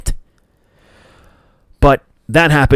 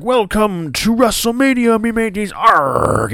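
much higher hammed-up pirate greeting; 10 semitones higher than usual. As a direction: play Titus doing a cheesy pirate welcome. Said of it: "Titus O'Neil's like".

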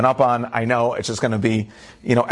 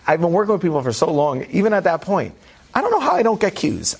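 ordinary delivery

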